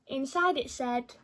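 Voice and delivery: ordinary delivery, nothing marked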